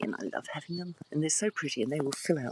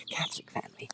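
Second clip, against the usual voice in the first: a soft hushed whisper; 10 dB quieter, faster than usual. Real, not acted. Whispering to viewers while family sleeps upstairs. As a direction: breathe it so nobody wakes. Sister-in-law asleep upstairs.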